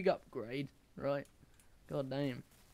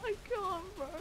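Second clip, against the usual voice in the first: laughing. Real, not acted. Breaking up with laughter.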